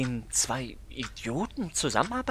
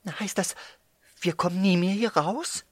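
scared; 10 semitones higher, 5 dB louder than usual. Acted. Scared child asks if trapped.

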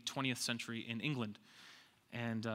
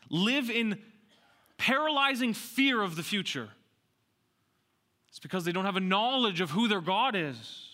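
raised, challenging sermon voice; full-force preaching; 6 semitones higher, 5 dB louder than usual. Real, not acted. Preacher confronting fearful believers, full-force preaching. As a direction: press the question on the congregation.

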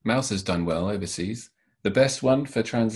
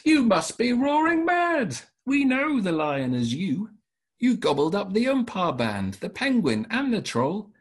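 accusing rabbit; 11 semitones higher than usual. Acted. Rabbit confronting the lion in a read-aloud, high indignant voice. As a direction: squeak out the accusations as a brave little rabbit. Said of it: "The rabbit says".

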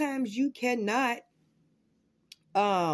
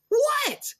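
mock surprised what. Baby rolling her eyes at him.

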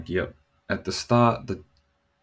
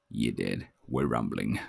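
much lower, hammed-up gruff kid, growled; tough son flavor; 10 semitones lower, 5 dB quieter than usual. Dad imitating his rowdy son with a much lower gruff voice. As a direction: son acting rough, voice dropped low. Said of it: "he only gets one of two flavors".

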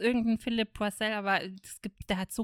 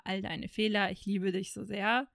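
ordinary delivery

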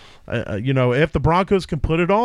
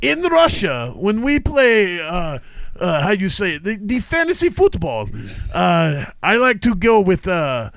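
put-on Russian accent. Host losing a bet reads his lineup, Russian accent. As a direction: talk fantasy football like a Russian. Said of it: "Three is Russian".

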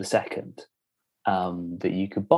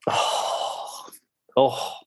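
ordinary talk, nothing marked

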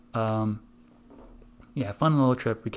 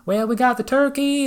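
high, loud counter worker holler; yelled answer; 13 semitones higher, 6 dB louder than usual. Retold or imitated. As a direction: yell back over the crowd. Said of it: "yelling across".

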